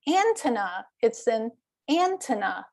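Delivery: ANTENNA said as AN-tenna, first syllable stressed; misplaced stress. Retold or imitated. Colleague repeating himself to a puzzled shopper, misplaced stress. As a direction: repeat antenna with the weight on AN both times. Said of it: "he had the stress on the wrong syllable".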